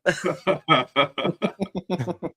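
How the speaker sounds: breaks into real laughter; finally lets the laugh out. Real, not acted. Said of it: "I was trying not to laugh".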